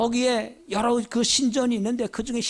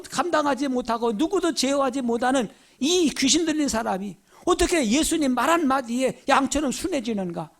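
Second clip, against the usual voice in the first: usual speaking voice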